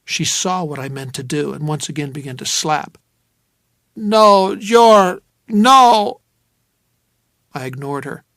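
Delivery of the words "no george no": loud, high pleading; frightened woman begging no; panicked protest. Acted. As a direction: cry out against the shower. Fears the cold shower.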